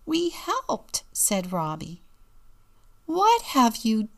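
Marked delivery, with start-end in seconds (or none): 0.1-1.0 put-on high, pleased small child; high-pitched kid voice; little boy's voice. A narrator's reading. Story reader voices little Robbie. 3.1-4.1 loud, high, drawn-out outcry; mother's raised voice, each word stretched; dismayed cry. A narrator's reading. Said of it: "cried Mommy".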